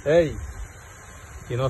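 ordinary delivery

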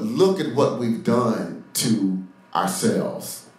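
drawn out with pauses between phrases; slow right down, let each part land; slow emphasis. Real, not acted. Preacher confronts the congregation with their self-deception, slow and weighty.

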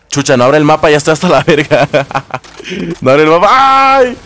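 loud, high outburst breaking into laughter; mock exasperated yell. Streamer voicing a player whose map won't open, mock exasperated yell.

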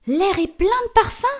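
high, delighted exclamation; ecstatic. Acted. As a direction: gush with wonder. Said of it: "s'extasie la mère". (ravie et extasiée)

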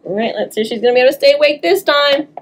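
usual speaking voice

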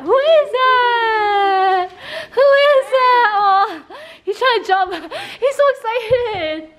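high, drawn-out dog voice; excited cooing. Real, not acted. Owner watches dog trying to jump, excited cooing. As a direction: squeal and coo over the excited pet.

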